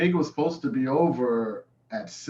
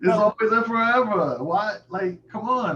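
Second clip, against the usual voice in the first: high, loud mock indignation; mock outrage; 10 semitones higher, 5 dB louder than usual. Real, not acted. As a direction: protest as if personally offended. Outraged about remix.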